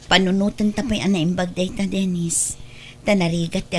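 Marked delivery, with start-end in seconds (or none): none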